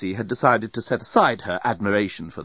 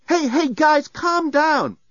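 much higher-pitched woman's voice calling for calm; high, raised plea to settle everyone; urgent appeal; 4 dB louder, much slower than usual. A narrator's reading. Nettie pleads with panicking friends.

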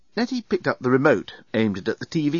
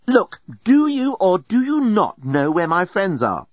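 slow, higher, emphatic questioning; exasperated demand; 8 semitones higher, 4 dB louder, slower than usual. A narrator's reading. Passenger turns on bellbot, exasperated. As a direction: spell out the question with strained patience.

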